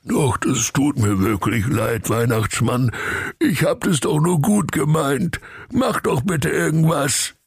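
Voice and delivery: sheepish apology, rising in pitch; meek. A narrator's reading. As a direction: plead meekly, a touch whiny. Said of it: "Der Ruprecht sprach ganz kleinlaut".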